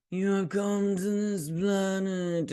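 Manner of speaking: high, slow, drawn-out alien-sounding voice; mock his odd voice, stretching every word; imitated character voice. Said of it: "I don't like the voice he did".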